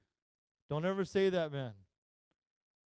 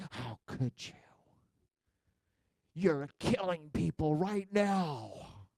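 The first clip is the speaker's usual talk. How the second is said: slow, drawn-out horrified reproach; hammed-up, low, slow accusing voice; mock accusation; 4 semitones lower, much slower than usual. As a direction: imitate an appalled shopper, stretching the words. Acting out horrified staring shoppers.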